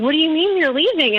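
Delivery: put-on incredulous boyfriend. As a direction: imitate a partner caught off guard. Boyfriend reacts to her leaving.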